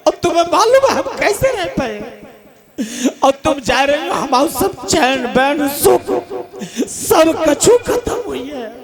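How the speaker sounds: hammed-up blubbering husband; mock weeping husband. Husband begging his departing wife at the station, mock sobbing. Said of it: "फपक फपक के रो रहे थे".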